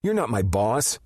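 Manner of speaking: high, loud, biting retort; defiant teen biting back at Rip. A narrator's reading. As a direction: snap it back at him. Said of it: "Boston bit back".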